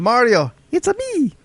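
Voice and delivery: squeaky Italian plumber voice; high Mario catchphrase voice. Pretend. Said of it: "Mario impression".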